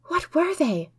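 breathless whispered question; gasped whisper. A narrator's reading. Maud asking Noble while fleeing, whispered gasp. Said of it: "I gasped".

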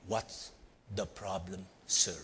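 low, quiet, halting threat; acted tough guy's slow challenge; menacing big man. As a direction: pause between phrases, soft and deep. Big man confronts him, acted.

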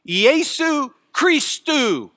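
"Iesu Christou" high and slow; emphasized Greek. Shown as a demonstration. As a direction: draw out "Iesu Christou" with weight. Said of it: "your first word for emphasis".

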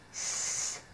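S as a long voiceless hiss; letter sound. Shown as a demonstration. Teacher sounding out sauce, S as a soft sustained hiss.

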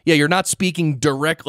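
ordinary talk, nothing marked

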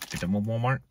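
ordinary delivery

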